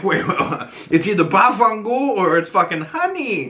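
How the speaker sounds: high, incredulous mock protest; baffled viewer. Viewer confronting the accent switch, high mock disbelief. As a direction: play the baffled viewer throwing up their hands. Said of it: "And you're like".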